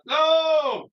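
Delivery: high, horrified yelp of no. Real, not acted. Streamer yelping at a five o'clock start, dismayed. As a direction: squeal a dismayed no at the early alarm. Dreading the early alarm.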